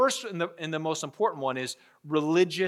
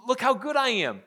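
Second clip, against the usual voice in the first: higher, bragging show-off voice; mock boasting; 6 semitones higher than usual. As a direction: puff yourself up like a braggart. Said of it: "These Judaizers put on a good face".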